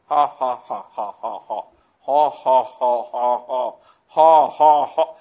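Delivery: loud, evenly spaced mock laughter; deliberate forced laugh. Shown as a demonstration. Preacher showing how Hagin laughed at Satan, forced laughter. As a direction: force out separate, low "ha"s in bursts. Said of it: "I was laughing like this".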